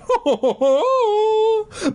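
very high, drawn-out cackle; hammed-up high dummy laugh; ugly evil laugh. Dummy after letting the girl go, ugly laugh. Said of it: "he uttered his ugly laugh".

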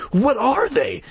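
high, scared question; alarmed at the creatures; frightened cry. A narrator's reading. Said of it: "Mike cried".

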